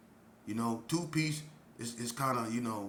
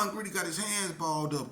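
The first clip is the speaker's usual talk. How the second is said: ordinary talk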